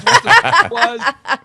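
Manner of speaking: laughs through the words, high and loud; breaking up with laughter; laughing. Real, not acted. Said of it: "laughing mid-story".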